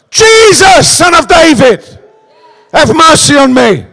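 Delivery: high, loud cry to Jesus; desperate shout. Acted. Blind beggar crying out to Jesus, loud plea. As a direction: plead at the top of your voice.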